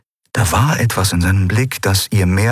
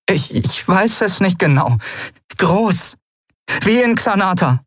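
hesitant fear; 11 semitones higher than usual. A narrator's reading. Lien unsure, frightened.

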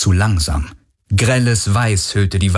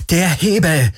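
high, urgent cry without much extra volume; calling out; 8 semitones higher than usual. A narrator's reading. Galen directs Kirai to the lever, urgent call. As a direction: point it out with a raised, pressing call. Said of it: "rief er".

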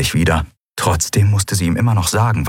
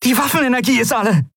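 alarmed cry; faster than usual. A narrator's reading. Pilot's weapons just failed.

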